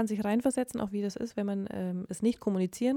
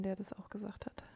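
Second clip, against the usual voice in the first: whisper; 11 dB quieter than usual. Real, not acted. Leise Bemerkung nebenbei.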